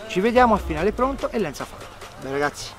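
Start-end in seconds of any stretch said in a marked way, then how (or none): none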